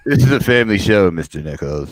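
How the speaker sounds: laughing pretend teacher scolding; a light hammed-up prim rebuke; prim mock scold. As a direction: tut at him like a prissy host. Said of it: "Watch that potty mouth".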